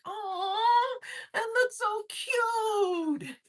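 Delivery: very high, drawn-out coo; imitated gushing coo. Recalling a pretty Savannah Airbnb from a movie, cooing.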